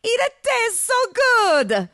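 very high, loud, stretched-out rapture; hammed-up foreign lover's cry; bellowing. A narrator's reading. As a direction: bellow it slowly in ecstasy. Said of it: "Marco bellowed".

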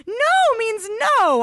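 high, loud, slow refusal; forceful drawn-out protest; shouting. A narrator's reading. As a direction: shout each word firmly. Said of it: "I shouted".

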